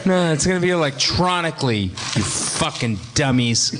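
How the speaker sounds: ordinary delivery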